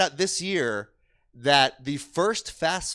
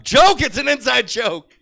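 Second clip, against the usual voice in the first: loud, slow correction; 9 semitones higher, 10 dB louder, slower than usual. Acted. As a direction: spell the correction out.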